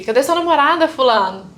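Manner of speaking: high, loud and cheerful; excited, innocent greeting to an old friend; bright cheery question. Retold or imitated. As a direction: ask it brightly, not suspecting anything.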